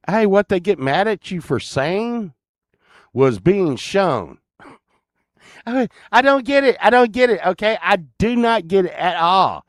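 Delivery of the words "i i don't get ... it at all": exasperated. Real, not acted. Exasperated rant.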